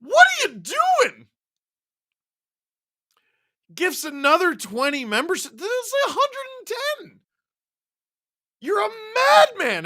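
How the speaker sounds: very high, incredulous cry at a huge gift; astonished squeal. Real, not acted. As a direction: squeal your disbelief at the donor. Reacts to big membership gift.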